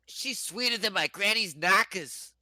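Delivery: high-pitched, botched British turning Irish-Brooklyn; comic Irish-Brooklyn mash-up accent; old Irish lady from Brooklyn. Acted. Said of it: "You sound like an old, like, Irish lady in Brooklyn".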